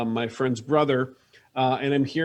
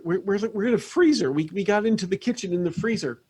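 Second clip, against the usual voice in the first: higher voice, halting and rambling; flustered Beth; 5 semitones higher than usual. Acted. Beth tells Roy she is in the freezer, flustered. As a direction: fumble toward the answer, pitched up.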